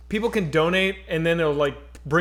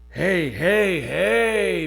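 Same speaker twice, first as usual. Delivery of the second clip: put-on mugger voice; much slower than usual. Mugger voiced in retold story.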